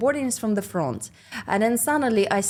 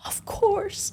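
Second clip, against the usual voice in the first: eager of course; 12 semitones higher, 4 dB quieter than usual. Retold or imitated. Serving the celebrity eagerly.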